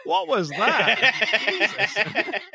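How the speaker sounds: high, drawn-out words through laughter; laughing. Real, not acted. Co-host reacting to a botched accent, laughing. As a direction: crack up while asking.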